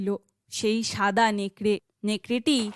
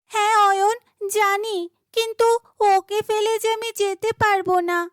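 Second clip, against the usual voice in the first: put-on young girl, higher and earnest; pleading heroine; 7 semitones higher than usual. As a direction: insist gently you won't abandon it. Heroine refuses to leave deer.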